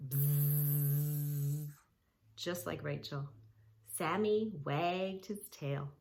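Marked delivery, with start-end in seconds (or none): 0.1-1.7 a buzzing bee sound. Shown as a demonstration. Showing the kids a bee buzz, playful buzz. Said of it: "use that sound".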